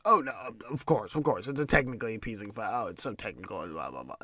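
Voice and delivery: sarcastic, dismissive imitation of a commentator; a lightly voiced, condescending commentator; condescending mock. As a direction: mimic a smug know-it-all brushing it off. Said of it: "hit the condescending button and go".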